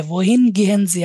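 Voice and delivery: slow, deliberate German example wohin gehen Sie; slow classroom model phrase; slow model sentence. Shown as a demonstration. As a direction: say it word by word for learners. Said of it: "প্রশ্ন হবে wohin দিয়ে".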